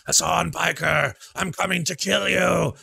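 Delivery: high, slow, loud unhinged yell in a put-on voice; mock Sam Hyde Irish yell. Mocking Sam Hyde's callout of Hassan, hammed-up high yell. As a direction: bellow the threat like a crazed boxer character. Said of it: "'cause it's always like".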